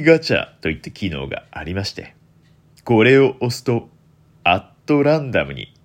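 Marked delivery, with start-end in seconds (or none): none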